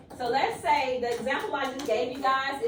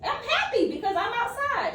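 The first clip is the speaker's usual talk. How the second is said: happy excited demo; 10 semitones higher than usual. Shown as a demonstration. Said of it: "Well, do you have an example".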